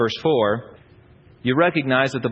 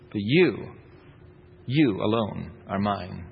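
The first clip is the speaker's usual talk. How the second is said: tender vow; 7 semitones lower, 4 dB quieter, slower than usual. Acted. Lover's closing vow.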